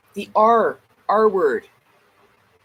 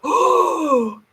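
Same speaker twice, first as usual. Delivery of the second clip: acted gasp, higher and louder; big mock gasp of shock; crowd's shocked gasp; 6 semitones higher, 5 dB louder than usual. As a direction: gasp like a scandalized audience. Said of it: "And everyone's like".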